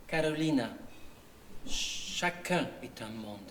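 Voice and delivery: softly translating her father's words for the audience; tender translation. Real, not acted. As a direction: say it gently, like a dear memory.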